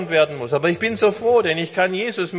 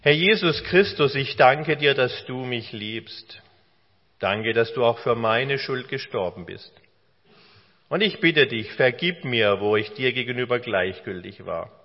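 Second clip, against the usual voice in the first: spoken prayer; 5 semitones lower than usual. Acted. Leads the door-opener prayer aloud.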